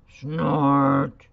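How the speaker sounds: a loud rhinoceros snort; rhino snort. Acted. Teacher reading to kids, snorting loud like a rhinoceros. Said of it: "says the rhinoceros".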